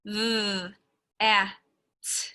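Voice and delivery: "th, a, t" as three separate sounds, voiced th; sounding out. Shown as a demonstration. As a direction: sound out "th, a, t" with a gap after each sound. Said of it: "I'm gonna sound it out".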